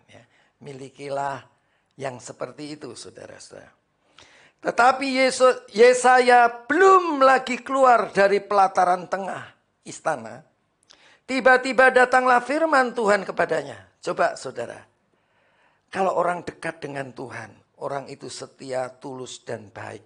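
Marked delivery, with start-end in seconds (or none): 4.6-13.9 loud, high scripture reading; full-force preaching. Acted. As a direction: proclaim it to the congregation. (membaca ayat dengan suara khotbah lantang)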